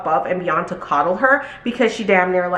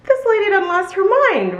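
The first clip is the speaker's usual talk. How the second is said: a higher, sassy "done lost her mind"; incredulous inner voice; 12 semitones higher than usual. Retold or imitated. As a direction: think it with disbelief. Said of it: "but I'm like".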